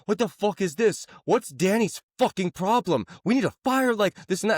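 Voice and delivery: angry boss yelling. Retold or imitated. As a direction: blow up at the employee, high-pitched. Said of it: "And he's like".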